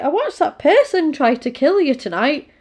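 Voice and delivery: a child scolding the woman, a touch of mock outrage; little girl scolding her for doing nothing. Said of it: "she's like".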